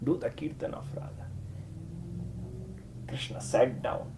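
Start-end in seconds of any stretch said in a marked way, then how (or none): 0.0-1.2 whispered; a soft whispered instruction. Shown as a demonstration. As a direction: whisper it like a secret. Advice repeated as a whisper.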